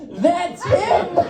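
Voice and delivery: high, drawn-out mock outcry; mock accusation. Woman identifying the man from the restroom, high mock accusation. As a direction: point out the culprit in a high imitated voice.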